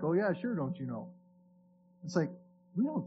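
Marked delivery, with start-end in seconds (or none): 0.0-1.1 lightly imitated Wisconsin accent, low and soft; a hint of Wisconsin in a coworker's catchphrase. Guy from work at Home Depot, mild Wisconsin accent. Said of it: "kind of up from the Wisconsin area".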